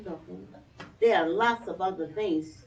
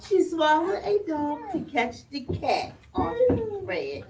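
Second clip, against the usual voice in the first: plain reading voice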